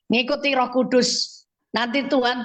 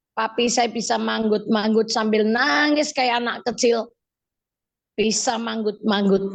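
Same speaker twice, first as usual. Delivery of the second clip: normal talking voice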